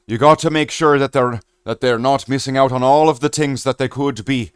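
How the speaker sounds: mock Irish rant, fast and loud; playful Irish impression for the chat; Irish accent. Shown as a demonstration. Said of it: "able to do quite a convincing Australian accent".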